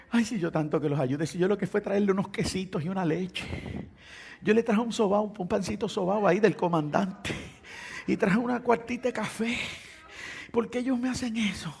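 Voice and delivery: soft, tearful-style complaint; hammed-up hurt whining; mock self-pitying lament. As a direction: moan over all your wasted kindness. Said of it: "sentarme a llorar".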